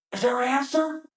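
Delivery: high-pitched mock yell; angry drill instructor impression; imitated instructor's scream. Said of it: "screamed at you for your answer".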